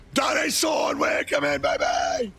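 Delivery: loud, high hype greeting; hype shout. Real, not acted. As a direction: yell it out to the chat, pumped up. Hype welcome.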